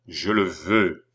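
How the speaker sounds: low, resolute command; willful command. A narrator's reading. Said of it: "un redoublement de volonté et d'action".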